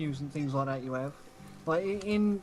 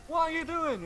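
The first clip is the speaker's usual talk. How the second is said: much higher, excited question; squeaky high voice; 11 semitones higher than usual. Retold or imitated. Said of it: "he goes".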